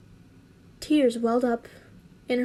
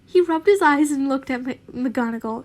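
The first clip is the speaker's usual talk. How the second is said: ordinary delivery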